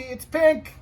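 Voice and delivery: high, loud wail of dismay; dismayed cry. Acted. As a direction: cry out over the ruined engine. Chief sees his pink engine.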